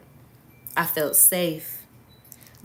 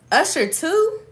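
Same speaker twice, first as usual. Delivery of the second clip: high, rising question of mild revulsion; revolted; 11 semitones higher than usual. A narrator's reading. Kimura reacting to the footage with revulsion. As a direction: pitch it up, appalled at the news. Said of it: "Kimura said with revulsion".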